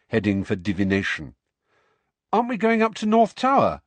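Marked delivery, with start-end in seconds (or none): 2.3-3.8 put-on much higher and louder, baffled boy's question; puzzled. A narrator's reading. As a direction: ask it genuinely confused. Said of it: "looking puzzled".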